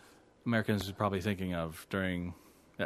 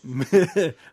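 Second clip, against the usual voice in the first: loud real laugh at own mistake; burst of laughter; 6 semitones higher, 9 dB louder than usual. Real, not acted. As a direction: crack up laughing, loud and high.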